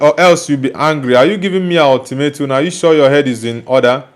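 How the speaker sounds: ordinary talk, nothing marked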